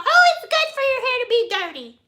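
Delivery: mock YouTuber in a high, quick voice. YouTubers giving viewers hair advice, mocking imitation. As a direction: chirp it mockingly, pitch way up. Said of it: "all of these YouTubers tell y'all".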